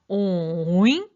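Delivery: 오잉 slow, dipping low then swinging up; third tone. Shown as a demonstration. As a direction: scoop 오잉 down and back up, drawn out. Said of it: "내려갔다 올라가면서". (오잉을 길게 내려갔다 올라가게, 삼성으로)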